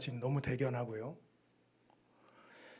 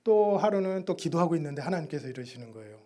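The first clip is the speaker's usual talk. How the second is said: ordinary delivery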